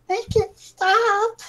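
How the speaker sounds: very high, slow wailing plea; princess crying out. Acted. Princess begging the brawlers to stop, high slow wail. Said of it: "she cried repeatedly".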